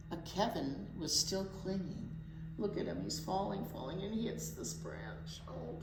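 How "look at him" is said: usual speaking voice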